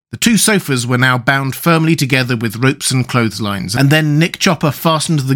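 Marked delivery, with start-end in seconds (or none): none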